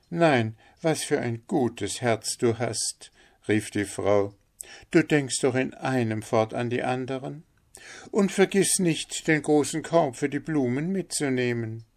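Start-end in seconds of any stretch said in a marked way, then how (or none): 0.1-3.1 higher, admiring wife voice; lightly voiced doting wife; miller's wife. Wife praises her husband. 4.9-11.8 high-pitched wife character voice; raised, lilting story-wife voice; miller's wife. Acted.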